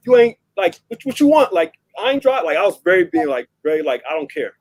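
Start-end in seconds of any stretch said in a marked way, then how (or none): 0.1-2.4 retold defiance, pitched up; higher, brash challenge to authority; cocky bravado. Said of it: "bravado and laughing in their face". 4.1-4.5 low, soft, careless brush-off; retold cool indifference, voice dropped; nonchalant. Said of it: "like, nonchalant".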